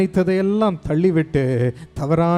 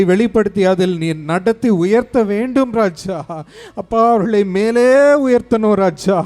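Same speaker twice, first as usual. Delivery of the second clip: higher, louder impassioned intercession; fervent preaching prayer; 4 semitones higher, 3 dB louder than usual. Acted. Pastor pleads aloud to God for the congregation, fervent. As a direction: cry out to God for the people.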